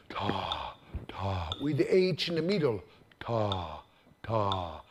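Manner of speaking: very low "ta, ta" demo; deep sound. Shown as a demonstration. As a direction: drop the voice way down on "ta". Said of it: "This is very deep sound".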